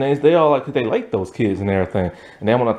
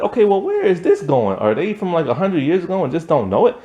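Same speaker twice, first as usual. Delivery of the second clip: higher, puzzled, a touch amused; puzzled wondering; 6 semitones higher than usual. Retold or imitated. Viewer puzzling over the twist, amused wondering. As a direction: wonder it aloud, lightly amused. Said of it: "it's kinda like".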